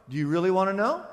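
ordinary talk

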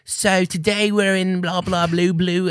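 slow, high mock posh British accent. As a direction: ham up a fake British YouTuber intro accent. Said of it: "Stop met een Brits accent op te zetten".

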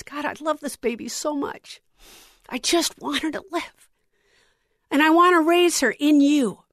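a touch of retold tearful pleading; tearful prayer. Mother praying for her sick baby, mildly tearful. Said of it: "And I started to cry".

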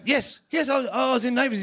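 high, over-eager confession; comic frantic yes; mock desperate eagerness. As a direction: blurt it out high, desperate to please. Desperately confirms role to attackers.

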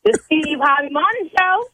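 high-pitched, half-crying answer; overcome relief. Real, not acted. Pranked woman naming the radio show, overcome and high.

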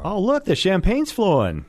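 high-pitched mock-excited cheer; playful celebration. Host teases his newly engaged sidekick, playful celebration. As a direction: pretend to marvel at a party.

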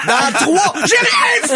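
high shrieking protest; panicked child shout. Retold or imitated. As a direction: yell it like a caught kid.

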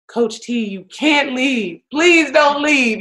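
mock pleading of upset players; pleading kids. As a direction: imitate kids begging their coach to stay.

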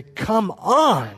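acted forceful rebuke, slowing for emphasis; forceful exasperation. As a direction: scold them as if they should know better. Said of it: "could be so forceful and say".

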